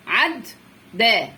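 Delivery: عد, pause, then ده; teacher breaking the doubled د across عد and ده; split in two. Shown as a demonstration. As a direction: sound out عد and ده as separate syllables. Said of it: "ده هيتفك اتنين".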